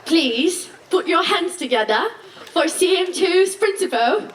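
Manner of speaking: put-on British accent with a showy, rising announcer build to the name. Student welcoming the principal to the podium, mock British accent. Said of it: "excuse my British".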